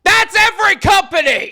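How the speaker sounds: high, very loud outburst; exasperated shout. Real, not acted. Host blasting the secret at critics, exasperated shout. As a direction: yell the secret right in their ear.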